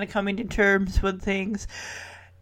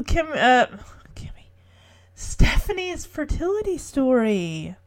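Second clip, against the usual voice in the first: ordinary talk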